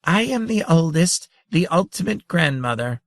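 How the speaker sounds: high, bright tiny-creature voice, pleased with herself; gaily. A narrator's reading. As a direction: announce yourself proudly and merrily. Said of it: "one said gaily".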